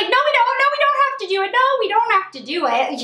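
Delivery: high, loud desperate begging not to be moved; panicked pleading. Retold or imitated. Panicked refusal before stretcher move.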